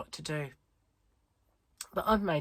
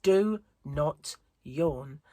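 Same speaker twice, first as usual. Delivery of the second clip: very slow, stretched, pleading self-order; slow drawn-out command; 5 dB louder, much slower than usual. Retold or imitated. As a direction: drag each word out wearily. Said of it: "my inner monologue from yesterday is going".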